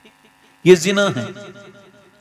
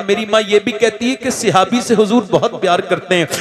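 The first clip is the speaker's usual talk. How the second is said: ordinary delivery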